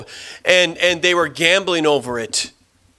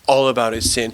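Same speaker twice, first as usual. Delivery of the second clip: ordinary talk, nothing marked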